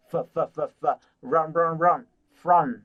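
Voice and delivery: "phở" repeated as the f sound of "from"; breaking down from. Shown as a demonstration. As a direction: drill "phở" as the opening f. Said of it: "Bạn sẽ nói là from". (tách âm "phở" làm phần đầu của "from")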